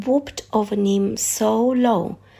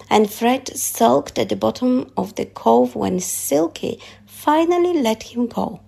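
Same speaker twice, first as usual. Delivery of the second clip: usual speaking voice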